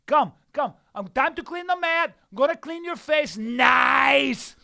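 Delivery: high, loud comic bravado building to a shout; hammed-up fighter's boast. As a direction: build to a gleeful mock shout. Acts out his boastful comeback.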